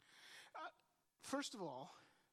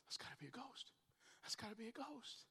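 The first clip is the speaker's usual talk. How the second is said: hushed, frightened murmur, lightly acted; whisper-soft dread as if seeing a ghost; 2 semitones higher, 8 dB quieter than usual. Disciples terrified at sea.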